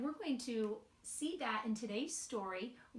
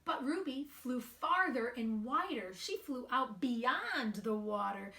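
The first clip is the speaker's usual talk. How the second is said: slow, sing-song storybook reading; gentle storybook narration with light character voices; reading to children; 2 semitones higher than usual. A narrator's reading. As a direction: read it aloud to a group of little kids. Reads picture book to children.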